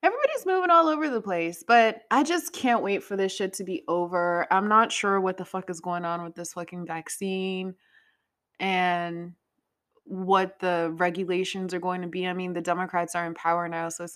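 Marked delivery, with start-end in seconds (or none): none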